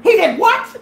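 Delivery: high, loud mock outburst; outraged disbelief. Drunk girlfriend hears of the acid, outraged disbelief. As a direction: blurt it out in shocked outrage. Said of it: "Drunk Tweety goes".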